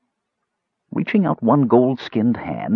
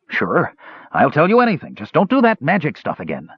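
higher, hurried pleading; eager frightened thief; 7 semitones higher than usual. A narrator's reading. Captured thief pleads eagerly with the mage. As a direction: say anything to be spared.

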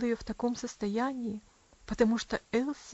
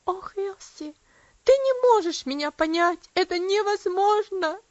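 higher and louder, pleading in despair; passionate outcry; 7 semitones higher, 7 dB louder than usual. A narrator's reading. Aunt crying out to the girl in despair, passionate and loud. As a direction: cry it out with passion. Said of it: "страстно воскликнула девушка".

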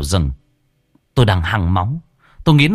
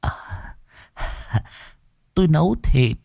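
ordinary delivery

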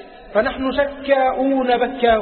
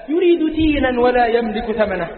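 ordinary talk

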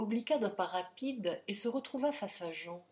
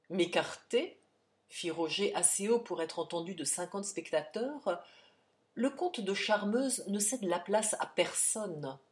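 ordinary delivery

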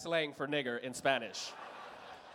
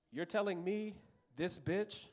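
ordinary delivery

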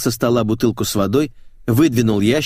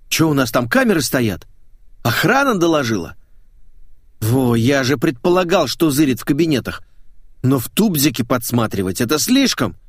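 higher, lively outraged protest; indignant; 4 semitones higher than usual. Acted. Lad protesting cameras in the toilet, indignant. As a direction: complain like you've been spied on. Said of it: "возмущался Федя".

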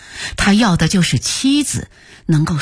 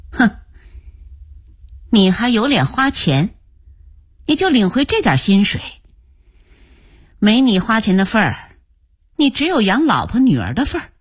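scornful snort, then higher, cutting reproach; sneering scorn; 4 semitones higher than usual. A narrator's reading. Wife belittling husband after his pay cut, sneering. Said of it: "对她丈夫冷笑道".

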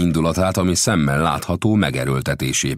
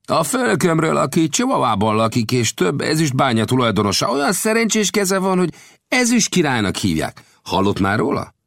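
higher-pitched voice for the gambusino, earnest storyteller; gambusino's voice; 13 semitones higher, 4 dB louder than usual. A narrator's reading. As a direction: tell the tale as the friendly gold digger. Gambusino describes his boss.

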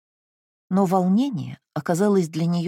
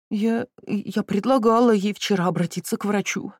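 moaning; 5 semitones higher than usual. Acted. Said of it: "простонала миссис Фредерик".

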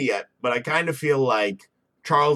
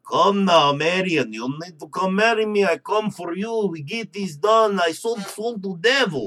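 put-on lightly done foreign villain accent, broken English; a hint of a foreign accent, clumsy and menacing; mock villain accent; 4 semitones higher than usual. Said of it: "Maybe I'm doing the wrong accent".

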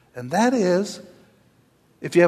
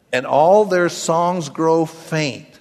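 slow scripture reading; 2 semitones higher, much slower than usual. Acted. Reading scripture slowly, fading.

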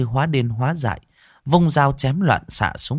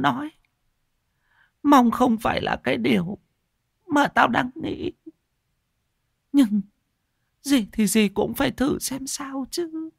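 put-on old woman's voice. Old mother voices dark suspicion.

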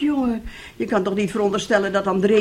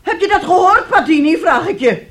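loud, higher, outraged scolding; indignant outrage; 7 semitones higher, 5 dB louder than usual. Acted. Mother furious, urging her husband to scold their daughter. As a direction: demand he punish her.

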